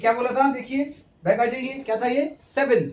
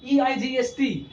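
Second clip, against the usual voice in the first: ordinary talk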